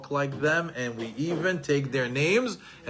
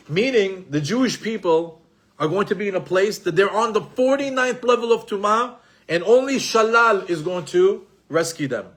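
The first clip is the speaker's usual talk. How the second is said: higher, louder, pressing preacher's voice; preaching; 4 semitones higher, 5 dB louder than usual. Acted. Rabbi preaches redemption to his congregation, higher and louder. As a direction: drive the redemption message home.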